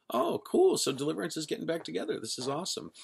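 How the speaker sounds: high, fast, thrilled retold delight; excited. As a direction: squeal it out like great news. Retelling joy at reunion.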